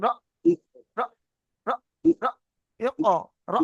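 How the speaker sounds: "র" chopped short with a glottal catch; clipped, jolted "র"; jolted closed stop. Shown as a demonstration. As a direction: drill "র" in abrupt single jolts. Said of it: "মিট বন্ধ অবস্থা বলেন".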